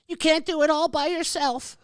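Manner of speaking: high mock whine of can't; mocking critic voice. Streamer mimicking a chat critic's advice in a high mocking voice. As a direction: parrot the critic sneeringly.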